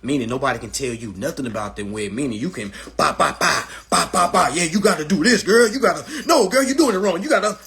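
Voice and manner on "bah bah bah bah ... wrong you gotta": put-on higher, louder bossy girlfriend voice; bossy wig expert. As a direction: school her on how it's done. Wig expert schooling someone.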